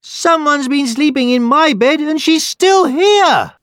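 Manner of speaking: very high, loud little bear squeal; baby bear crying out. A narrator's reading. Baby Bear discovers Goldilocks in his bed, squealing. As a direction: cry it out as a shocked bear cub. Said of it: "the baby bear cried".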